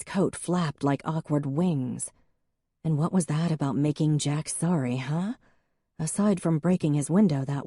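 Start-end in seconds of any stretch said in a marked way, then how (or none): none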